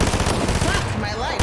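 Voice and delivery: slow, loud drawn-out groan of a yell; despairing yell. Real, not acted. Streamer giving up mid-fight, slow loud despairing yell. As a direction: drag it out, loud and defeated.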